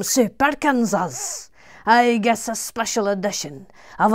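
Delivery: put-on loud Glasgow accent read. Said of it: "do your Glasgow accent".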